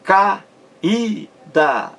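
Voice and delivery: Caída split into ca, í, da with pauses; syllable by syllable. Shown as a demonstration. Teacher counting três sílabas, Caída broken syllable by syllable. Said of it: "três sílabas".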